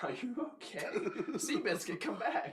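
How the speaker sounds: high-pitched laughing tease. Real, not acted. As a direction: giggle out the joke. Teasing while laughing.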